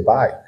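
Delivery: "bike" with i said as eye; English I sound. Shown as a demonstration. Teacher gives learners an example word, "bike" with eye vowel. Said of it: "on a dit, c'est I".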